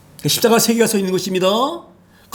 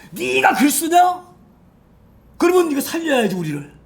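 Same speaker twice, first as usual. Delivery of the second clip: jeering condemned thief; 7 semitones higher than usual. Retold or imitated. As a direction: sneer it up high like a frightened criminal. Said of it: "욕지를 퍼붓는 거예요".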